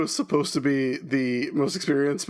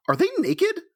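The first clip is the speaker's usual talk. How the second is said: much higher-pitched mock character voice; excited Venus. Venus spotting the mirror ladies, high-pitched outburst. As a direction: pitch it way up, as Venus blurting a question. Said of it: "for Venus to just go".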